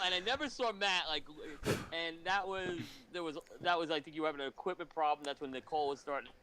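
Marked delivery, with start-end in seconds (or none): none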